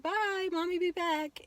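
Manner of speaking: high, drawn-out mommy goodbye; goodbye to toddler. Retold or imitated. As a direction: call a long, high bye to your little one. Saying goodbye to her young son.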